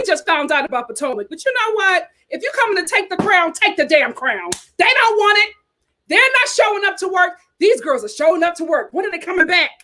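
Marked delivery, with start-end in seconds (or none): none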